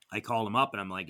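normal talking voice

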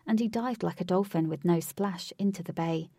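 ordinary delivery

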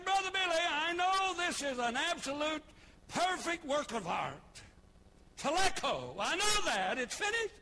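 butter Billy up at full volume; hammed-up pleading. Preacher to Brother Billy before the axe, loud mock plea. Preacher acts out axing pulpit.

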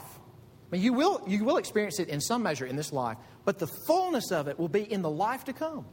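ordinary delivery

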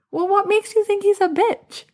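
incredulous question. Retold or imitated. Challenging her friend about the crying husband, high incredulous question. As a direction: push back, baffled. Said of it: "And I'm like".

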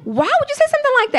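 put-on high, fast, indignant little-girl voice; child's shocked question. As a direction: blurt it out like a stunned child. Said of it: "I'm like".

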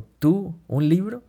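sarcastic, incredulous question, pitched high; tono sarcástico. Retold or imitated. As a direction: imitate a scornful doubter, each part rising sharply. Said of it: "le respondió con un tono sarcástico". (tono sarcástico, pregunta incrédula y aguda)

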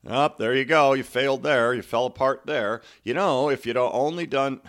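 loud, needling sideline critic; mock critic. Coach imitates the people who criticize, mock fault-finding. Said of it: "You're gonna have those people".

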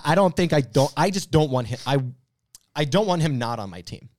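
ordinary talk

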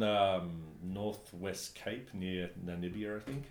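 ordinary talk

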